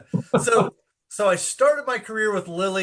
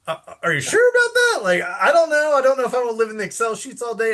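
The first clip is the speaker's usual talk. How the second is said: high-pitched, exaggerated disbelief, easing slightly; retold doubtful protest; 8 semitones higher, 4 dB louder than usual. Newcomer replying to mentors about research, comically dubious and high. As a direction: squeak out your doubts, hammed-up.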